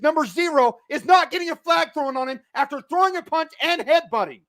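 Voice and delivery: high, loud outraged yelling at the refs; shouting in real disbelief; furious rant. Real, not acted. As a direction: rage at the missed call. Furious fan ranting at missed flag.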